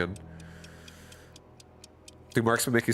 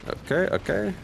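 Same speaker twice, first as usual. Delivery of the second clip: startled okay; 12 semitones higher than usual. Real, not acted. Reacting to enemy bombardment in game.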